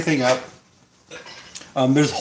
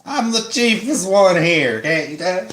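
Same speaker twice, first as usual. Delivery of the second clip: hillbilly voice; 2 semitones higher than usual. Acted. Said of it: "You like my hillbilly voice?".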